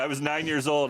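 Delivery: ordinary delivery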